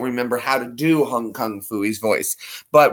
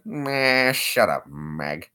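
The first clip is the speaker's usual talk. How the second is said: gruff cartoon dad dismissal; drawn-out grunt, then a dropping, dismissive name; cartoon father brushing someone off, falling away; Peter Griffin; 5 dB quieter, much slower than usual. Shown as a demonstration. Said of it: "Peter Griffin?".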